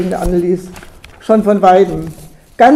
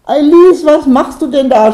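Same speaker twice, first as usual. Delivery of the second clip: loud, high cry of surprise; startled shout; 6 semitones higher, 8 dB louder than usual. A narrator's reading. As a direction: yell at her, caught by surprise. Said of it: "schrie der Überraschte die Bäuerin an".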